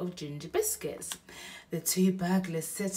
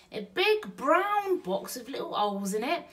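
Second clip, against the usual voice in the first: higher, wondering woman's echo; Betty echoes Bill, higher and wondering; Burglar Betty; 9 semitones higher, 6 dB louder than usual. Acted. Betty repeats Bill's line.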